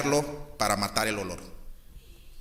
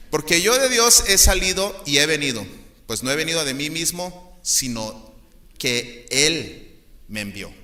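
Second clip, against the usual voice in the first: put-on old-fashioned Bible reading; 4 dB louder than usual. Pastor voicing Jesus's reply to the crowd, archaic and solemn. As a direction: intone the old Reina Valera verse. Continues the scripture quote.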